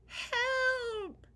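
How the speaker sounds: high, loud cry from far off; cry for help. Acted. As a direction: call out desperately for help. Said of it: "came a cry".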